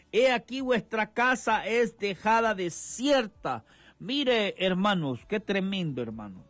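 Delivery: rhythmic sermon cadence, hermano at each phrase end; fervent evangelical preaching; radio preaching. Acted. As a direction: preach the word to the radio flock.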